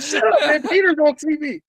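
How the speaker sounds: high-pitched comic brush-off, laughing; retold impatient hushing. Acts out hushing his coworkers.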